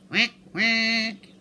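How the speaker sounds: loud, high quacking duck; duck quacks. Acted. Duck voice on phone.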